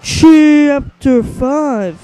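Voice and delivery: slow, grand title reading; drawn-out chapter announcement. Acted. Streamer announcing the chapter title to viewers, slow and grand. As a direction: announce the chapter like a show host. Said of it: "introducing the damn chapter".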